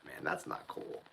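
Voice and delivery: normal talking voice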